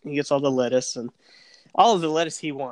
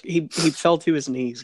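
ordinary delivery

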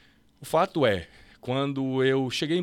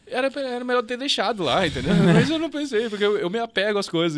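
laughing; 10 semitones higher, 7 dB louder than usual. Real, not acted. Laughing at his own mistake.

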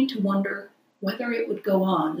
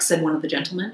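plain reading voice

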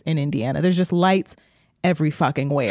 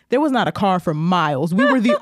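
usual speaking voice

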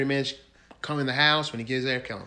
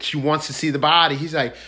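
ordinary delivery

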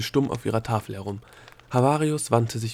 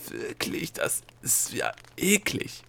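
slow, higher whisper of disgust; 7 semitones higher, 3 dB quieter, slower than usual. A narrator's reading. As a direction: whisper it, drawn out and squeamish. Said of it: "flüsterte Delia".

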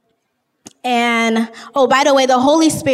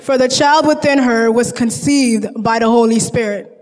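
reading scripture aloud. Acted. Reading the angel's message to sleeping Joseph, solemnly. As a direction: read the angel's words with weight. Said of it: "I'm gonna read what the angel said".

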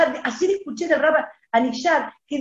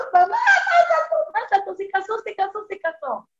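mamá exultante; 7 semitones higher than usual. Retold or imitated. Le cuenta a su madre gritando.

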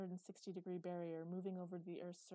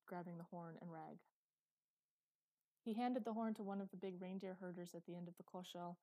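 ordinary delivery

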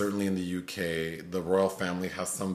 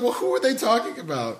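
higher, puzzled wail; confused crying woman; 11 semitones higher than usual. Retold or imitated. The crying woman in the video, mock confused. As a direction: ask in hammed-up tearful bafflement. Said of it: "she's like".

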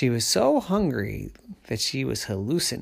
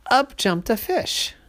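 high, louder burst of surprise; sudden excitement; 11 semitones higher, 4 dB louder than usual. A narrator's reading. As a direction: pop it out like a surprise. Surprise jump in story.

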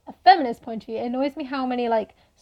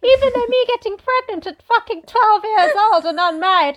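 high, loud, gushing mock delight; hammed-up willing maiden, pitched high; Virgin Mary; 9 semitones higher, 5 dB louder than usual. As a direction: put on a sarcastically eager young girl's voice. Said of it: "if you read the Bible, it's like".

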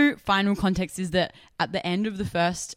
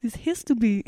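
put-on Russian accent; 4 semitones higher than usual. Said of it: "read this in a Russian accent".

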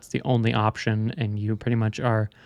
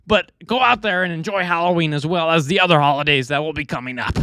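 put-on reading a quote in a high Brooklyn accent; 6 semitones higher than usual. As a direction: raise the pitch, thick Brooklyn accent. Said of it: "little Brooklyn accent".

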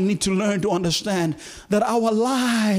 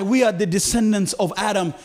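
normal talking voice

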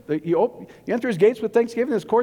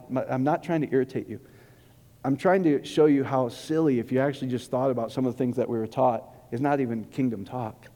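usual speaking voice

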